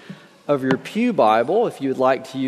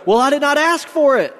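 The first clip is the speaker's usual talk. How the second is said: high-pitched, mildly exasperated objection; indignant protest; 12 semitones higher, 3 dB louder than usual. A narrator's reading. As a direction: push back against the burden. Man protests to dangling stranger.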